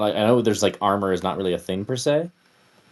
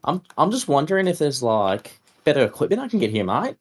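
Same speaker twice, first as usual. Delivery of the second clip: put-on voice of a polite customer; higher start, easing down into a friendly request; chummy "mate" character; 7 semitones higher, 4 dB louder than usual. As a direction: play a matey shopper asking a favour. Player voices character asking vendor for equipment.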